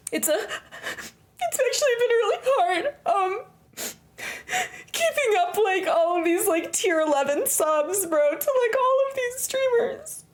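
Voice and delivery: high, slow fake-sob whine; mock crying. Streamer fake-crying to chat about keeping up with subs. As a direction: whimper it like a hammed-up crybaby.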